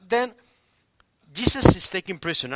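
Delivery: ordinary talk, nothing marked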